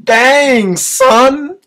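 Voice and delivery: exclaiming. Acted.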